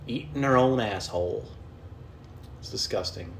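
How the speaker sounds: normal talking voice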